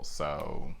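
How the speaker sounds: slow, deadpan mock woohoo; half-hearted little celebration; unenthused cheer. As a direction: cheer slowly with no excitement. Unenthused cheer at more snow.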